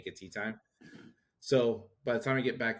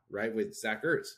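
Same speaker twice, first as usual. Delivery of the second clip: ordinary talk, nothing marked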